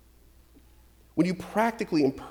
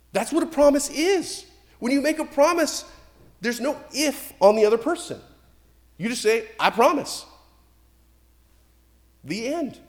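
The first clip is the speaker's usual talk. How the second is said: higher and louder, driving the point home; preaching at full force; 10 semitones higher, 7 dB louder than usual. Acted. Pastor pressing the congregation on what a promise is, full force. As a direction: preach it hard, pitch raised.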